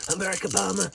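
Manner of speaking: a light, quiet, poor imitation of a president; Barack Obama impression. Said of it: "Or Barack Obama".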